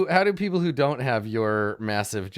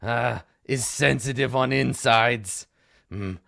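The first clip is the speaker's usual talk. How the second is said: big gnoll; 2 semitones higher, 6 dB louder than usual. Acted. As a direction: complain about his tummy earnestly. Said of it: "he pokes his belly and he says".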